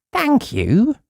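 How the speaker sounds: high bright thank-you; mean it for once; cheerful thanks. Acted. Uppity thanking the newspaper man, high and glad.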